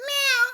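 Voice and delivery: high mock cat meow; a squeaky, high cat's meow. Shown as a demonstration. Teacher mimics a cat for the class, high meow.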